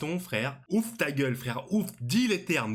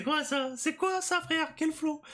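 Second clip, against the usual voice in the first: amazed high squeal; slower than usual. Real, not acted. Viewer reacting to the admiral's entrance, amazed high squeal. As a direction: voice jumps way up in disbelief.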